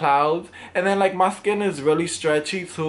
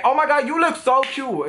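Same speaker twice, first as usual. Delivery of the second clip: higher, emphatic, assertive gush; aggressive compliment; 8 semitones higher than usual. Shown as a demonstration. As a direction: push the compliment out high and forceful. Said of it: "I just talk very aggressively".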